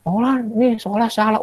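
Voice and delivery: high, soft voice of a pupil; pupil correcting teacher. Retold or imitated. Teacher voicing a pupil who says his answer is wrong, high and soft. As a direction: point out the mistake politely. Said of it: "Pak gurunya sering dikritik".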